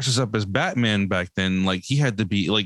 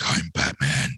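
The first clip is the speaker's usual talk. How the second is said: lightly lowered gravelly-free mock Batman growl; a kid doing his Batman voice, half-hearted; imitating Batman voice; 7 semitones lower than usual. Mother retelling her son's Batman voice, lightly imitated. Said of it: "he's doing the whole voice".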